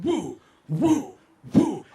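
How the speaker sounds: high, punchy "woo" bursts; imitated spinning whoosh. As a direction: whoop out each swing of the plane. Imitating the spinning plane's sound.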